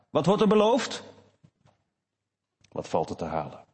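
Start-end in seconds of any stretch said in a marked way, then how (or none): 0.1-1.0 greedy question, pitched up and louder; voice of unbelief. Acted. Preacher voicing unbelief asking what is promised, raised and grasping. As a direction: ask it like unbelief eyeing the prize. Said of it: "Ongeloof, dat zegt vaak".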